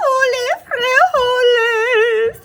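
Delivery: high, loud, slow battle cry; drawn-out heroic shout with the thrust. A narrator's reading. Scapito thrusts his sword at the bandito, shouting a battle cry. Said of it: "cried Scapito as he thrust his sword".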